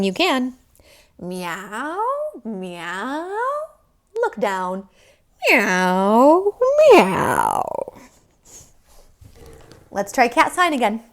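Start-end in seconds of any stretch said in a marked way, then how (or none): 1.2-3.3 cat song meows. Pretend. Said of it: "if you want to sing a cat song". 5.5-8.0 cat song meows. Pretend. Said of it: "if you want to sing a cat song".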